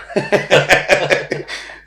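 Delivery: loud, high burst of real laughter. Real, not acted. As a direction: laugh because he can't swear on camera. Laughs because he can't swear.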